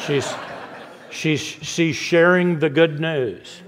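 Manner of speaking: chuckling through the words, slow; amused, laughing as he tells it; slow words broken by a real laugh. Real, not acted. Preacher laughs at own story.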